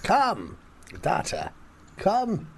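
high, breathy mock-sultry call; hammed-up come-hither to Data; lightly voiced Captain Picard seducing Data; mock Picard seduction. Mock seductive Star Trek roleplay.

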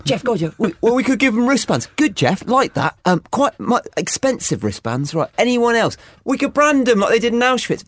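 high, upbeat corporate manager voice; a mock manager fishing for ideas; chirpy boss. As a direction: chair the meeting like an eager cheerful boss. Mock board meeting boss.

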